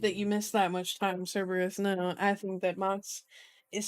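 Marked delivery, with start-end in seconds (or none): none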